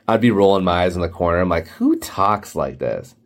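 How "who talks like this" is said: low, slow, drawn-out disbelief; mildly appalled, lower and dragged out; exasperated disbelief. Retold or imitated. Employee reacting to boss's jargon, mock exasperation.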